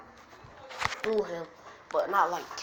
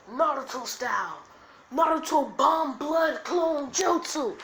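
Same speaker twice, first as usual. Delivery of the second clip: raised, loud jutsu call, slowing; battle cry; 7 semitones higher, 7 dB louder than usual. Acted. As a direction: shout the move, drawing it out. Acted anime attack call.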